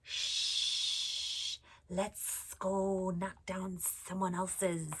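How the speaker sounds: slow, hissing snake voice; sneaky snake. Acted. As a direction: hiss it slowly like a sly snake. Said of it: "and the snake said".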